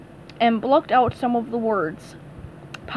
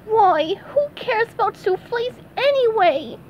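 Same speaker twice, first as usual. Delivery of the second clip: much higher cartoon shrug-off; squeaky brushing-off voice; dismissive SpongeBob; 10 semitones higher than usual. SpongeBob shrugs off the soufflés in a high voice.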